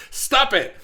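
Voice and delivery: loud stop it, pitch jumping up; playful retold yell; mock shout. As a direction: bark the line at them. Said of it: "That was".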